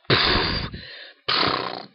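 wet spitty raspberry blow; spit take. Real, not acted. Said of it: "I spit all over my computer".

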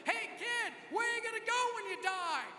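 high, loud reenacted holler; screaming. Zealous friend running at a terrified kid, screaming.

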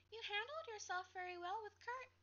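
a shy classmate offering praise; friendly girl. Acted. Kayla compliments narrator.

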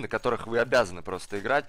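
ordinary delivery

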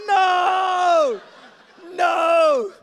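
very loud, high No; horrified shout. Retold or imitated. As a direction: recoil in horror. Horror at nephew's comment.

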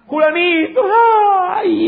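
high mock wail for the dead; hired mourner wailing. Pastor imitating paid mourners at a Colombian funeral, mock wailing. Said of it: "comenzaban a llorar".

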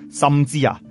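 usual speaking voice

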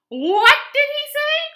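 very high, loud shriek of disbelief; astonished cry. A narrator's reading. Frogs hearing a pig say ribbit, astonished cry. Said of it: "cried the frogs".

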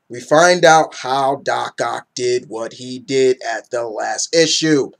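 slow, drawn-out wrestler-promo delivery; Ric Flair. Acted. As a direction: stretch each word like a wrestling promo. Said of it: "I'm talking like Ric Flair".

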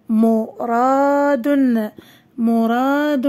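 very slow, stretched vowels; each long vowel held; drawn-out word reading. Shown as a demonstration. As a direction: sound the word out slowly for young pupils. Teacher sounds out word for pupils.

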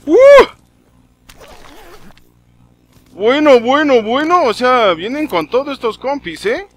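high and loud, keyed up; excited. Real, not acted. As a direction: react hyped to the ambush. Emboscada, reacción agitada. (agitado y emocionado)

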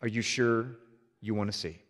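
very low, slow and soft, each phrase drawn out; a deep hushed voice asking if he truly wants to see; voice of the Father. Retold or imitated. The Father speaking gently to the preacher, low and slow. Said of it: "And the Father said".

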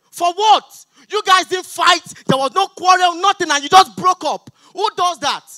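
incredulous scolding; mock-furious ranting; comic outrage. Ranting about breakup.

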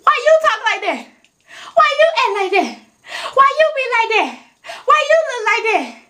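high-pitched mimicry of nosy critics; quick, high-pitched taunting imitation; mocking questioner. Mimicking people who question how she talks.

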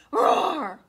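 loud playful roar; dinosaur roar. Pretend. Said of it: "and he goes".

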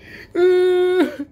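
loud high burst of laughter; a pained high laugh. Real, not acted. Laughs at own regret.

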